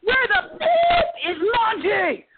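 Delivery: very high, slow mock yell; drawn-out, high-pitched furious yell, retold; Gordon Ramsay yelling. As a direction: imitate an irate chef hollering across the kitchen. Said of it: "starts yelling even louder".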